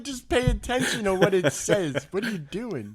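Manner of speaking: high, loud comic scolding; exasperated retold telling-off, drawn out; mock exasperation. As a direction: imitate yourself nagging in disbelief. Retold scolding of his wife.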